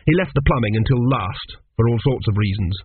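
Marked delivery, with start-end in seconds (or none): none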